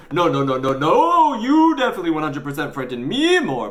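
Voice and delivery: loud rush of no's swooping high; emphatic protest. A narrator's reading. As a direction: protest hotly, drawing out the last no. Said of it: "protested Raj".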